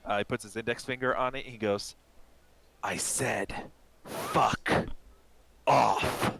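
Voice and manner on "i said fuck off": word by word, with long pauses; menacing dismissal. Acted. Shorter man squaring up to a big stranger, menacing. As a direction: land each word as a threat, hardest at the end. Human pokes player, repeats threat.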